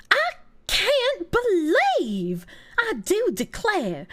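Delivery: very slow, high, swooping Southern drawl; hammed-up Southern belle aghast; pearl-clutching posh Southern. Shown as a demonstration. Streamer showing the pearl-clutching accent, slow Southern drawl. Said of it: "when I think of pearl clutching".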